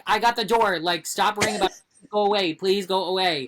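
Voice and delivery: loud, pleading go away; exasperated plea. Retold or imitated. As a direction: get him to leave, half laughing. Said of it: "I'm like".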